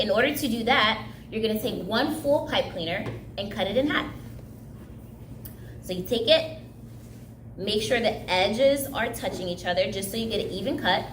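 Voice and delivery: ordinary delivery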